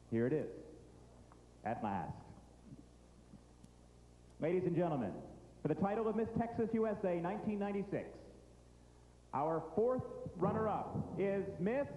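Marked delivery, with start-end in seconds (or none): 4.4-11.9 dramatic announcer building suspense; pageant host. Acted.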